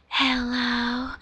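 soft, long drawn-out hello; imitated woman answering the phone. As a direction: mimic her drawling a quiet greeting. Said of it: "answers a phone like that".

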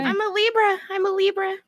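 high, tearful self-excuse; crying. Real, not acted. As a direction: plead it lightly through tears. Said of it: "I just keep crying".

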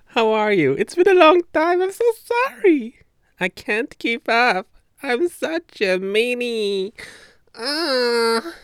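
loud, very high squeal; over-the-top high-pitched wail; gushing apology. Real, not acted. Gushing hello and apology to listeners.

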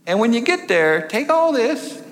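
prim lady, high and sing-song; falsetto church lady. Retold or imitated. Woman advises hiking trip.

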